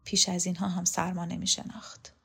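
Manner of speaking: soft whispered aside; hushed, barely voiced remark; under the breath. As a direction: whisper it to yourself. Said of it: "خانم ادریسی زیر لب گفت".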